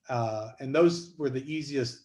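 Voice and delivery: usual speaking voice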